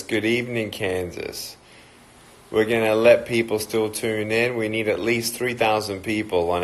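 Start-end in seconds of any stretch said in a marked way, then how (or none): 0.7-1.6 "Kansas" said low and much softer; soft repeat. Real, not acted. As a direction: murmur the name back gently. Repeating location softly.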